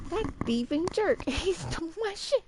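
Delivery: loud, laughing mock outrage; fake-angry while laughing; laughing indignation. Real, not acted.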